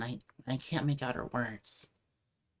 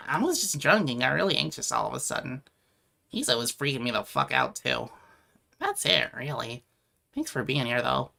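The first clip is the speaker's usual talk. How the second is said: normal talking voice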